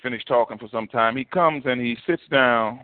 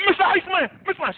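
much higher, starstruck holler of his name; much faster than usual. Retold or imitated. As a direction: shout for his hero across the crowd. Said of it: "started hollering".

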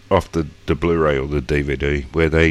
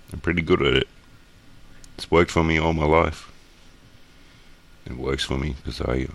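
normal talking voice

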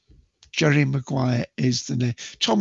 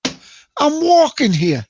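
high, slow, loud street-tough yell; New York tough guy; 11 semitones higher, 6 dB louder, much slower than usual. Shown as a demonstration. Quiz host shouting the movie line at a cab. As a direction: bellow it like a New Yorker dodging a cab. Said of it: "Got to have a bit of, you know, character".

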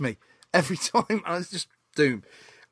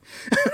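squealing laugh, much higher; high burst of laughter; 4 dB louder than usual. Real, not acted. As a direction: laugh out loud at the joke. Amused by dark line.

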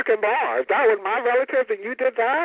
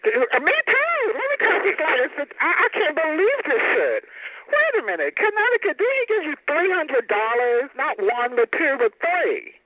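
high-pitched, incredulous outburst; worked-up disbelief; 7 semitones higher than usual. Real, not acted. Indignant host confronts other speaker.

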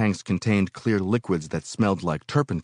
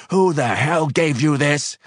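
high, loud, slow furious question; angry demand; 9 semitones higher, 6 dB louder, slower than usual. Acted. Foreman furiously demanding who gave him the liquid. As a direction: grill him hard. Boss angrily interrogating.

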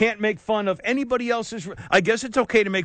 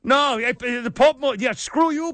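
furious rant; 6 semitones higher than usual. Real, not acted.

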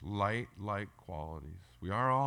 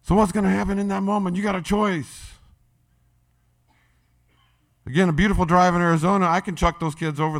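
loud and high, pushing hard; fervent preaching; 7 semitones higher, 11 dB louder, faster than usual. Acted. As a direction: drive the point home at full force. Preacher's rising dramatic build.

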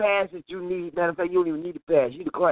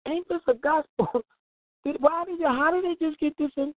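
mock disbelief; 12 semitones higher than usual. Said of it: "You're like".

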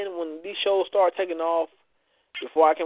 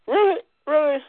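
incredulous; 9 semitones higher, 3 dB louder than usual. Real, not acted. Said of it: "mock disbelief at his nickname".

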